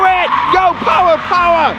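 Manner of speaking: high, loud shout of power. Shown as a demonstration. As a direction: bark the command power. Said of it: "shout power".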